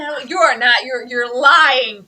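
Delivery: you're LYING, slow, loud and drawn out; mock outrage, nearly crying, words dragged out slow; accusing call-out. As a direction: hammer each word home slowly and loudly, like an accusation. Calling out the character Bradley.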